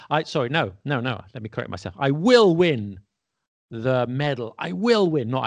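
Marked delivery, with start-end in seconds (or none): 2.0-4.5 strong raised stress on "will"; emphatic "will". Shown as a demonstration. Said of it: "because I'm being emphatic". 4.6-5.3 "will" pitched high with firm stress; high, determined punch on "will"; emphatic "will". Shown as a demonstration. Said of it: "because I'm being emphatic".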